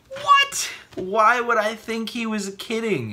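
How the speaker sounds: high, loud "what" of disbelief; incredulous outburst. Acted. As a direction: exclaim it, baffled at yourself. Mocks his own naive thought.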